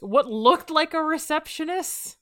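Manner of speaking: high, questioning disbelief at the wording; incredulous repeat. Real, not acted. Mocks the line just read.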